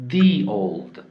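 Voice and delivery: put-on "the old" with THE as "thee" before the vowel; long ee sound in THE before "old". Shown as a demonstration. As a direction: say THE as "dee" in "the old". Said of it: "دقت کن به تلفظ من".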